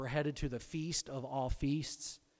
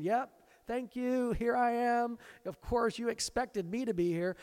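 mock self-important saint; 9 semitones higher, 4 dB louder than usual. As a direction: put on a high voice, basking in credit.

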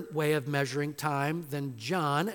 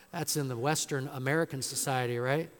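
usual speaking voice

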